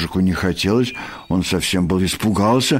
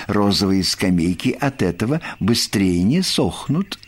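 ordinary delivery

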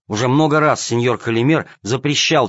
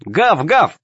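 mock barking; 7 semitones higher, 4 dB louder than usual. A narrator's reading. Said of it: "ответили хором семь котят".

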